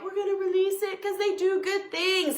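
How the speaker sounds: high-pitched imitation of husband reasoning; spider-sparing husband.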